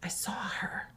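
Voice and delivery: unvoiced frightened whisper. Little girl under the bed whispers to her mother. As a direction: barely breathe it out. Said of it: "And she said".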